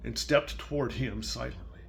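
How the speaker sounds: put-on reading to a child. Grandparent reading Salt Hands to a small child, gentle storybook voice. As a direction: read it softly, like a bedtime story. Reads picture book aloud.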